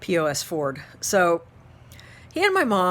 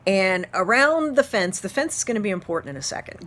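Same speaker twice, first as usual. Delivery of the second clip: normal talking voice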